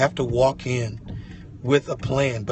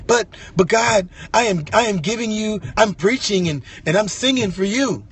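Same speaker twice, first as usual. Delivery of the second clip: much higher, louder pleading to God; insist, voice raised, that you're giving everything; pleading protest; 9 semitones higher, 5 dB louder than usual. Acted. Believer protesting loudly to God that he sings for him.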